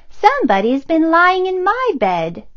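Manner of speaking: mother bear, puzzled and fussy; medium-pitched mother bear voice; middle voice. Acted. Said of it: "in her middle voice".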